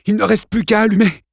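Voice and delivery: put-on high, pushed boy's voice; breathless kid forcing the words out; strained boy. Exhausted boy tells friends the plan, high and strained.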